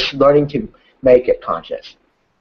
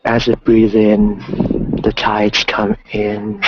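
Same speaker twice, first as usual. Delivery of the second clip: slow, calm meditation voice; guided meditation; 4 semitones lower than usual. Acted. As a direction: guide them gently through the breath. Guided ocean visualization.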